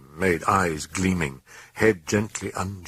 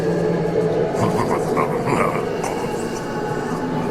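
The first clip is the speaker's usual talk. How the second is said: demonic chuckle. A narrator's reading. Demon laughing at the priest, eerie chuckle. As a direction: chuckle like a creature amused by its prey. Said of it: "Abruptly, it chuckled".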